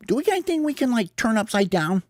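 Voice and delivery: high-pitched character voice; squeaky little brainstormer; bird suggesting an idea. Acted.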